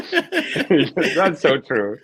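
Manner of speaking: slow words through laughter; high laughing agreement. Real, not acted. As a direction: say it while still laughing.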